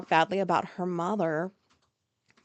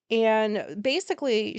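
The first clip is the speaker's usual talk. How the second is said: ordinary talk, nothing marked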